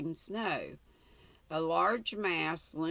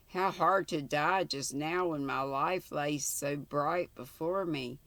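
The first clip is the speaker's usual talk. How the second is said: plain reading voice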